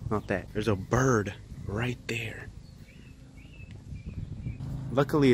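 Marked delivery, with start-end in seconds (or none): 0.5-2.5 whisper. Real, not acted. Spotting a bird quietly.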